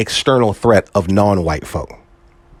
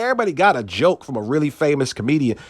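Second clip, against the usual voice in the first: usual speaking voice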